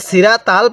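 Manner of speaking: সিরাতাল with thin a vowels and light t; short, light-lettered সিরাতাল; incorrect reading. Shown as a demonstration. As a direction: say সিরাতাল fast, no rounding. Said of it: "পড়াটা সহি শুদ্ধ হবে না".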